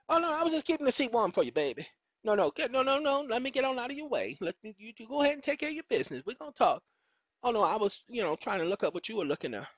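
mock sweet-talk to the returning partner. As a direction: act all innocent, hurrying out of the way. Acting himself caught by partner.

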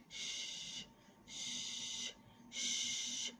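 "ش" as a long, soft voiceless hiss; sh sound. Shown as a demonstration. Teacher showing pupils the SH sound, "ش" drawn out and voiceless. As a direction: hold each "ش" as a pure sh with no vowel. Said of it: "طب ال اس اتش".

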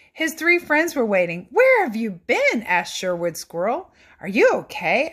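put-on lively read-aloud for children; animated picture book narration; storybook reading. A narrator's reading. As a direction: read it like bedtime story time.

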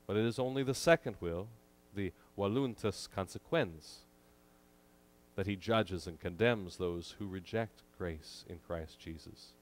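ordinary delivery

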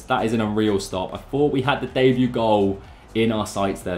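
ordinary delivery

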